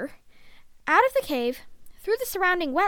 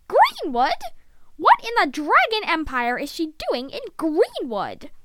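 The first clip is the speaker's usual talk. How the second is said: shocked disbelief; 3 semitones higher, 5 dB louder than usual. Acted. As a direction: demand to know, aghast. Shocked to hear sister's whereabouts.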